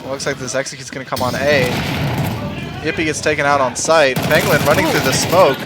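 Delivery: live esports caster read; play-by-play commentary. Acted. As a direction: call the round as it happens. Casting the match live.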